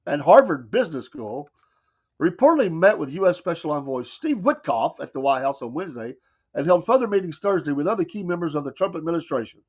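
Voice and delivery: usual speaking voice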